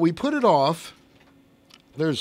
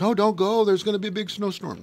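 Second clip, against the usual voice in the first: wife's voice; 8 semitones higher than usual. Retold or imitated. Grandpa voices wife in story.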